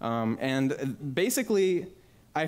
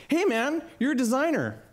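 higher, eager hey man; excited greeting; 10 semitones higher than usual. Retold or imitated. Friend's dad hailing the young designer, excited. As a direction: hail him like a fan.

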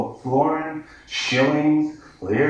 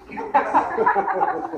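a short real laugh breaking out; brief delighted laugh; amused chuckle; 7 semitones higher than usual. Real, not acted. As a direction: laugh at your own line. Laughs at own joke.

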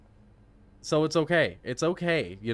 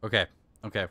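ordinary talk

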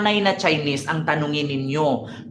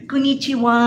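much higher breathy-style mock Japanese; mock Japanese moaning; 5 semitones higher than usual. Imitating Japanese women moaning, high mock coo. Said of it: "di ba may sinasabi silang".

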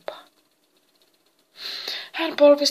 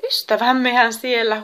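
loud, higher cry of delight; joyful exclamation; 4 semitones higher, 8 dB louder than usual. A narrator's reading. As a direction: exclaim happily at hearing friends. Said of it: "huudahti Epimetheus riemuissaan". (riemukas huudahdus)

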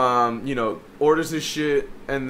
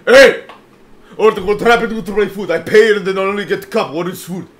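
put-on high, loud Egyptian-accented complaint; yelling, Egyptian accent; 5 semitones higher, 10 dB louder than usual. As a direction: burst in as an irate Egyptian customer. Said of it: "he's trying to yell at P again".

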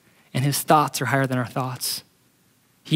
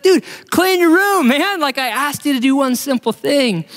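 much higher, louder, exasperated dad yelling; hammed-up dad losing patience over the messy room; fed-up dad; 10 semitones higher, 3 dB louder than usual. Retelling the analogy, dad yells at his son, high and exasperated.

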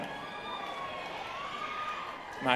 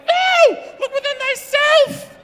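much higher and louder, shrill mock guru shout; shrieking yoga teacher; 6 dB louder, slower than usual. Comedian imitating the yoga teacher screaming back, shrieking. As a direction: screech like a choked sensei. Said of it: "He was like".

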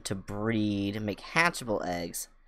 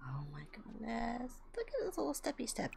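much higher, much softer breathy coo; cooing whisper; 10 semitones higher, 13 dB quieter than usual. Real, not acted. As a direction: gush softly at something adorable. Cooing over llama steps.

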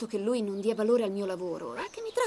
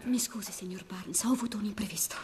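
hushed whisper to the boss; 4 semitones lower, 6 dB quieter than usual. Acted. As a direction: whisper so the room can't hear. Late arrival whispering during auction.

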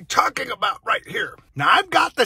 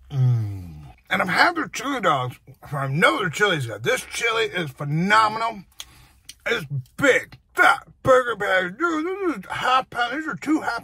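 talking between chews; muffled mid-bite words; mouth full, chewing; 2 semitones lower than usual. Real, not acted. Seen 3: Bites at -1, closed-lip jaw movement after, bites again 3.5.

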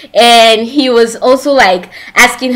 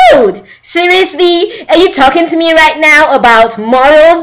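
indignant challenge; 6 semitones higher than usual. Retold or imitated. Acting mom confronting other mom.

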